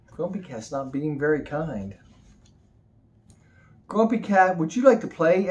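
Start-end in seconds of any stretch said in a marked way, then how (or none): 3.9-5.5 high, loud and eager; butterfly voice. Acted. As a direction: sweetly invite a friend to play. Butterfly invites cat to play.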